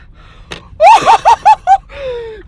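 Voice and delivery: high, loud whooping laugh; shouting laugh of joy. Real, not acted. Diver laughing over his record grouper.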